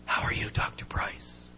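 voiceless whisper, barely any sound; breathy whisper. Retold or imitated. Sick patient greeting the doctor in a voiceless whisper. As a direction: whisper it like you can't use your voice. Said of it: "He did it like this".

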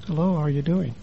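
soft hello the therapist approved; quiet greeting. Retold or imitated. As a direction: bring it right down to a hush.

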